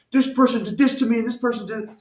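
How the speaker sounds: high-pitched, frantic complaining; freaking out. Retold or imitated. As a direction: act out a mock freak-out over petty grievances. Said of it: "freaking out".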